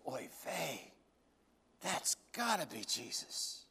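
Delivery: a stunned murmur of recognition; awed hush. Acted. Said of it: "Peter goes".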